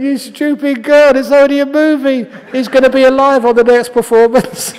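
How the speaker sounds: loud high teasing scold through laughter; mock scolding. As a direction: tease the weeping girlfriend. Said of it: "I said".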